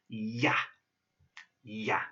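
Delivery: "ya" as a short ya sound; "ya" said like the start of yak; Russian ya. Shown as a demonstration. Teacher showing the "ya" letter. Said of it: "this is pronounced".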